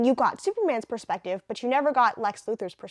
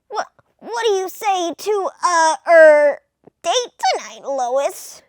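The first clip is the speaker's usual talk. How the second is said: high, stammering nerdy Clark; flustered nerdy reporter; 6 semitones higher, 10 dB louder, slower than usual. Acted. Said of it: "Clark's gonna have a nerdy voice".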